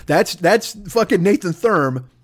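usual speaking voice

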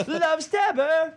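drawn-out falsetto; high slow silly voice. Pretend. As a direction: stretch the words high and slow. Repeating the bit in silly voice.

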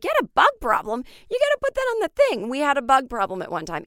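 high, loud disbelieving squeal; incredulous outrage. Retold or imitated. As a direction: shriek the disbelief back at her. Incredulous reply to landlady.

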